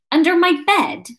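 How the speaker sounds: high, incredulous West Country question; suspicious. A narrator's reading. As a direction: query it with open suspicion. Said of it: "looking suspicious at her".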